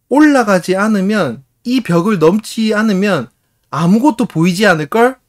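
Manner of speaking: 높고 크게, 또박또박 느리게; 한 구절씩 힘주어 외치는 아이; 결연한 아이 흉내. Retold or imitated. 아이의 결의 찬 대사. (당찬 꼬마의 선언)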